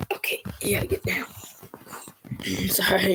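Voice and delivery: ordinary talk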